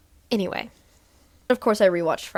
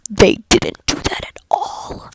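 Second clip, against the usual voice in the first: whispered upset; 5 dB louder, slower than usual. Real, not acted. Upset at ending.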